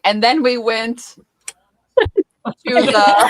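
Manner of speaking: slow, high, breaking up; like a frozen video call; imitating laggy video call. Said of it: "she's like".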